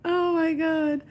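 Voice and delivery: high laughing exclamation; laughing oh my god. Real, not acted. Still laughing at David's joke, high.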